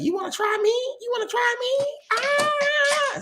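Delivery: very high, taunting mother; imitating an angry mother squaring up; mock challenge. Ex-wife voiced challenging son.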